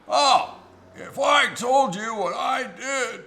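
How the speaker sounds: slow, loud, high menacing tease; ominous drawn-out shout. Retold or imitated. Passenger hints at a terrible deed, slow, loud and high. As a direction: drag it out like a dark confession is coming. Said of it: "the way he said it".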